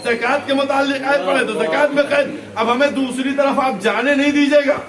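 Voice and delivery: high, loud insistent oratory; impassioned preaching. Real, not acted. Preacher arguing his point to the majlis, full-force preaching. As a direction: drive each parallel clause home at full voice.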